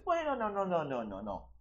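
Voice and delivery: string of noes sliding down and fading; dismissive. Real, not acted. Preacher rejects scholars' mystical theory, dismissive falling noes. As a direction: brush the theory aside, pitch falling away.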